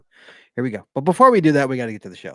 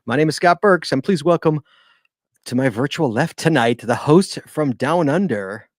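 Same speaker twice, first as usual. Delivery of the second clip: show announcer; 2 semitones higher, 8 dB louder than usual. Acted. Host welcoming listeners to the Geocaching Podcast, loud announcer delivery. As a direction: punch it out like a show opener.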